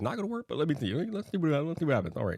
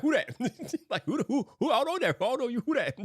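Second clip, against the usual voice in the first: mock disowning; 12 semitones higher than usual. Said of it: "I'm like".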